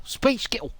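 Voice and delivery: high, squeaky Scottish accent; exaggerated high Scottish pronunciation. Shown as a demonstration. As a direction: pipe it up in mock Scots. Scottish version set against Spice Girl.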